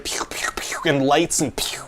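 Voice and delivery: mock laser effects, whispered; whispered pew pew. Writer pitching the fight scene, whispered pew sound effects.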